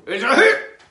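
high, loud burst; excited exclaim. Acted. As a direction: declare the hit proudly.

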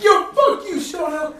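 high, loud taunt; heckler's yell. Retold or imitated. Fan heckling the opposing bench, loud yell. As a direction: bellow it across the bleachers. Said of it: "this guy who's like".

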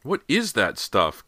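high, slow, loud cry of disgust. A narrator's reading. Girl holding her nose at green goo, crying out. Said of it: "Cody cried".

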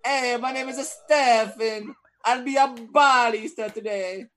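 high, hammed-up Italian accent; mock Italian accent. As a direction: parody a singsong Italian barista. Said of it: "you probably were like".

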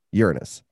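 Uranus as YOOR-uh-nus; first-syllable stress. Shown as a demonstration. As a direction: stress the front of Uranus, short middle vowel. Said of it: "they pronounce it Uranus".

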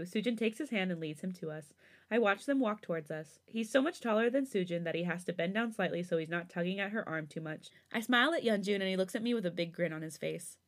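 ordinary delivery, nothing marked

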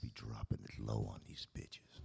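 dropped way down low and deep; deep bass voice. Acted. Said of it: "then you just dropped in the bass".

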